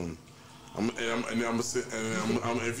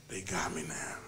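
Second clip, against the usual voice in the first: whispered; 4 semitones lower, 4 dB quieter than usual. Real, not acted. Mock dread, government watching.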